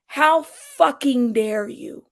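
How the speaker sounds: slow, drawn-out mock outrage; mock-indignant, slow and deliberate; stone-faced indignation. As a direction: imitate her deadpan indignation, every word stretched. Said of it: "in her, you know, stone face".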